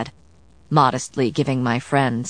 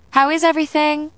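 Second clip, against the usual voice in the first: housekeeper voice; 11 semitones higher than usual. A narrator's reading. Housekeeper greets them.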